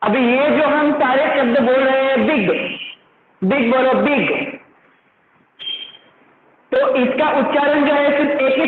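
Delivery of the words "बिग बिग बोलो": ordinary talk, nothing marked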